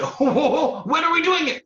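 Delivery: high, loud, laughing excitement; giddy rat. Acted. Rat thrilled about the race, high laughing voice. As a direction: bubble over with glee in a high rat voice. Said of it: "And the rat said".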